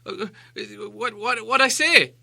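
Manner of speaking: high, breathless frightened hobbit; gasping stammer. Acted. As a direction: gasp it out in panic.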